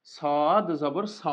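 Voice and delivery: "সদ জবর স" slow, with a thick s and rounded vowel; "সদ জবর স" spelled out slowly and heavily; heavy drawn-out Sad. Shown as a demonstration. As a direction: stretch "সদ", then a full "স". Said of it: "এটাই হচ্ছে পার্থক্য".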